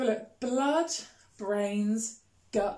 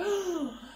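theatrical sharp gasp; mock gasp; 7 semitones higher than usual. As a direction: gasp to hook the children. Reacting to Griselda's ban.

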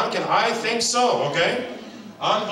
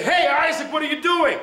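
raised voice; 10 semitones higher than usual. Retold or imitated. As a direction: shout to stop the boy running to the road. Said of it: "sometimes I have to raise my voice".

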